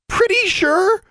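very high, loud, incredulous sputter. A narrator's reading. Roommate throws the girl's reassurance back, sputtering. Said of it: "Anna sputtered".

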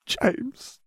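high, faint, broken murmur; dazed mutter. A narrator's reading. Sirius muttering, no longer seeing, dazed murmur. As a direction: murmur the name in a daze. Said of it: "he muttered".

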